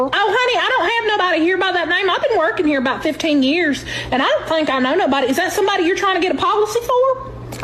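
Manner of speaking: sweet southern lady. Pretend. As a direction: coo at the caller like a sweet auntie. Fake insurance lady to caller.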